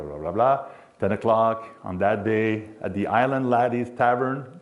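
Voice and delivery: normal talking voice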